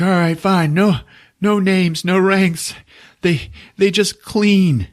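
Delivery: high, strained giving-in voice; mock whimpering surrender; acted pained confession. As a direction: give up the secret as a hurt captive. Wounded man gives in.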